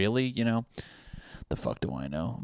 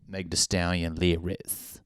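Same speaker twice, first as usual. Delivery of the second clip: ordinary delivery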